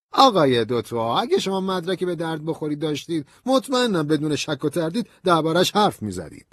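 high, emphatic colonel's voice; dismissive colonel. A narrator's reading. Colonel brushing off Ben's evidence, insistent and high.

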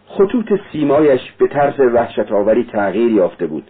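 usual speaking voice

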